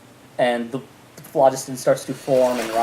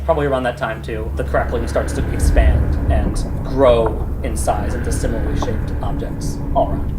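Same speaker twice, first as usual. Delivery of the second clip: usual speaking voice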